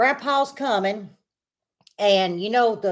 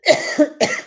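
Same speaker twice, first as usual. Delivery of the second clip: dry choking cough; 7 semitones higher, 3 dB louder than usual. Real, not acted. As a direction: cough to clear the throat. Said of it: "I'm really choking".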